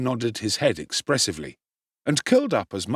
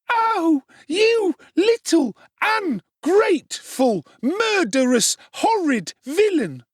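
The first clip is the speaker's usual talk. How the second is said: furious screaming; 5 dB louder, slower than usual. A narrator's reading. Charlotte beating Oliver.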